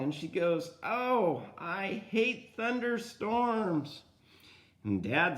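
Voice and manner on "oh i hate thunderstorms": high, slow complaint, mildly acted; upset child. Child answering dad's question, high mock-upset complaint. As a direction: voice a scared child's drawn-out protest.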